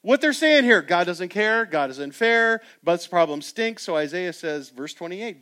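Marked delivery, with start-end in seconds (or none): 0.0-3.8 rally the congregation; preaching at full force. Acted.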